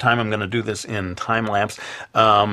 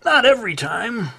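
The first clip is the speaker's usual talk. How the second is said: high, slow protest; indignant fan; slower than usual. Retold or imitated. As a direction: whine it like an offended manga fan. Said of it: "someone will say".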